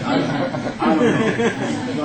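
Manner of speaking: laughing it off, loud and high. Real, not acted. Telling viewers about her lucky win, laughing. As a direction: chuckle through the words.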